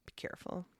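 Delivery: whispered warning. Real, not acted. To listeners about stage falls, a whispered warning. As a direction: breathe it as a soft aside.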